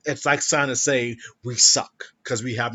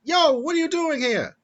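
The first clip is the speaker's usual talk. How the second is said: high, loud hammed-up New York accent; bad New York accent; 6 dB louder than usual. Shown as a demonstration. Attempting a New York accent for the co-host, high and loud. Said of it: "what a New York accent sounds like".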